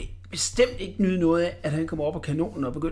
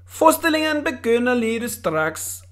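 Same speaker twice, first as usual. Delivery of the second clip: put-on higher, called out to the boy; shouting; 6 semitones higher, 3 dB louder than usual. A narrator's reading. The cannon king calls back to the boy, higher. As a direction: call across the circus ground. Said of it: "råbte han".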